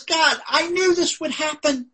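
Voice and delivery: high-pitched indignant complaint to God; angry Jonah. Retold or imitated. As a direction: act out an angry prophet protesting. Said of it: "he was angry".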